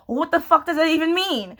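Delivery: incredulous, amused outburst; exasperated disbelief. Retold or imitated. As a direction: demand it, baffled and half laughing. Acting her baffled reaction to advice.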